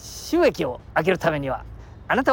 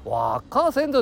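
mock retort; 8 semitones higher than usual. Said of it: "って言って".

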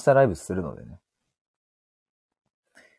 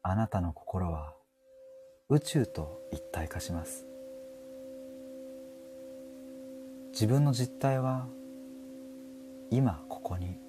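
mock guided meditation; 14 dB quieter than usual. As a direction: lead listeners into calm like a spiritual guide. Parody guided meditation, crystal ball. (ささやくような、ゆっくりしたパロディの瞑想誘導)

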